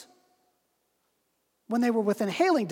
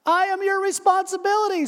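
high, raised voice; calling up; 9 semitones higher than usual. Acted. Dangling stranger calls up to the man. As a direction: call it up insistently.

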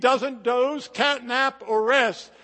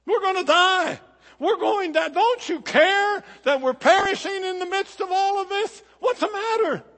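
panicked angry disciples; 10 semitones higher, 7 dB louder than usual. Acted. Disciples scolding sleeping Jesus.

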